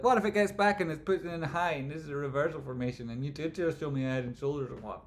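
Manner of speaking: a hint of a nervous trader; anxious trader. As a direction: voice a panicking trader. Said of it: "people will be like".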